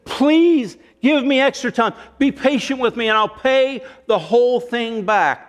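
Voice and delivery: high, begging plea from his knees; second servant on his knees, begging in a high plea; desperate pleading. Retold or imitated. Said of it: "He falls down to his knees".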